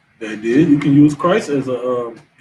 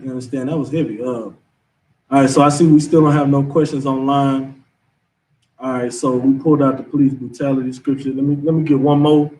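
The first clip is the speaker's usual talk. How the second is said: normal talking voice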